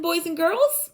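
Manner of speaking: ordinary delivery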